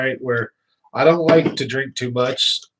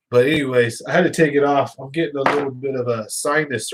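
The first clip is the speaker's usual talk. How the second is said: usual speaking voice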